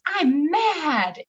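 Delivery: high-pitched imitation of a mad little kid; put-on angry toddler voice. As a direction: mimic a small child's angry declaration, pitch leaping up. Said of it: "he was so mad".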